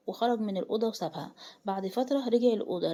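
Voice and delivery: usual speaking voice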